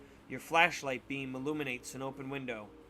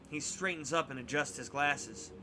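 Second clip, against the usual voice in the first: plain reading voice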